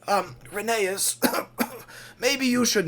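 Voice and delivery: hesitant. Acted. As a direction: timidly try to step in. Skarloey timidly tries to intervene.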